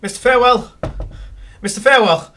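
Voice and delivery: shouting a name through the door; frantic calling. Acted.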